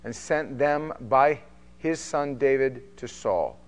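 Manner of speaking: measured, steady Bible reading; formal reading of scripture aloud. Acted. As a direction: read the passage with weight, slowing on key lines.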